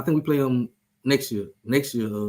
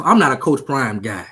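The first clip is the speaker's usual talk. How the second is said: normal talking voice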